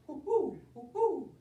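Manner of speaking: high, falling owl hoots; high-pitched owl imitation. Shown as a demonstration. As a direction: hoot like a little owl. Demonstrating the owl with its sign.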